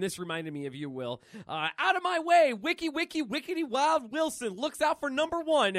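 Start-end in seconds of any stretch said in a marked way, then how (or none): 1.8-5.8 high, loud comic-hero bravado; loud boast. Acted. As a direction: shout it, barging through a crowd. Deadpool acted charging through a crowd.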